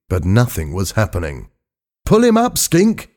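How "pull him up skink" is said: high, forceful command to a crewmate; urgent shout. A narrator's reading. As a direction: shout sharply up high, demanding action. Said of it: "shouted Hal again".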